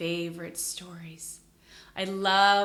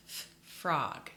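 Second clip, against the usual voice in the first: ordinary talk, nothing marked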